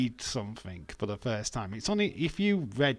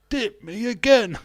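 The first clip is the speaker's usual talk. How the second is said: high, loud comic voice; Peter Kay impression; 12 semitones higher, 10 dB louder than usual. Streamer quoting Peter Kay about biscuits. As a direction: belt the catchphrase out. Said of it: "Peter Kay reference".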